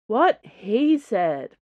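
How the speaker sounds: slow, loud, drawn-out moan. A narrator's reading. Porthos backs Doncaster's complaint with a long moan. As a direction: groan it out, weary of waiting. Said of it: "Porthos moaned".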